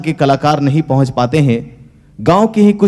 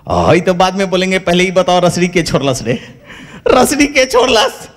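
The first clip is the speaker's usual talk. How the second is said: laughing complaint; 4 semitones higher than usual. Acted. Hanuman fell, rope dropped.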